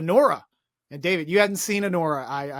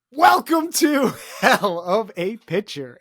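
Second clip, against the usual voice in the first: slow, loud show welcome; announcer voice; 4 semitones higher, 6 dB louder, slower than usual. Acted. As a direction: boom out the show name slowly.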